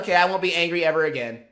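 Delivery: mocking Yuji. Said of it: "He's like".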